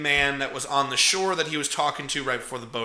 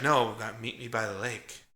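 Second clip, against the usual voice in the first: much softer and lower, a sinister hush; creepy hushed Angela; 7 semitones lower, 11 dB quieter than usual. Retold or imitated. Angela luring Paul to the lake, softly sinister. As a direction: breathe it like a creepy invitation. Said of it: "And then she's like".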